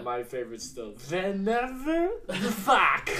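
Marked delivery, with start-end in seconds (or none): 1.1-3.1 slow, high hammed-up Russian accent; mock Russian accent. Quoting his favorite line to friends, in a mock Russian accent.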